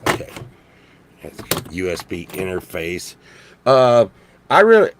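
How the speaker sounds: ordinary talk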